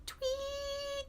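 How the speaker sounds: ordinary delivery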